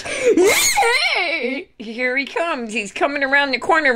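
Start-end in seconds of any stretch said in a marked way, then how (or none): 0.0-1.7 high, laughing shout; excited shout. Real, not acted. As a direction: burst out with a cheer. Sudden shout in race play.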